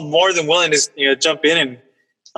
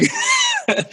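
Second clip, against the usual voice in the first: high burst of laughter; laughing; 4 dB quieter than usual. Real, not acted. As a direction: laugh it off, self-conscious. Laughs at own joke.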